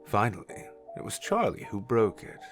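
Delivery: plain reading voice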